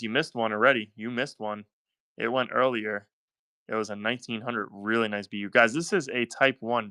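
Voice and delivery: usual speaking voice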